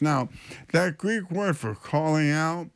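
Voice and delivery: ordinary delivery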